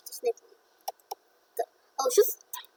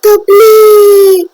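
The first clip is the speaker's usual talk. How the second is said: much louder, slow, higher anguished wail; real gamer despair, slow and loud; loud drawn-out why; 6 semitones higher, 9 dB louder, much slower than usual. Real, not acted. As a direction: stretch the why out in frustration. Gamer dies and loses progress.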